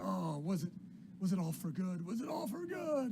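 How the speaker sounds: high, loud anguish climbing to a wail; agitated old William. Acted. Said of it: "He says".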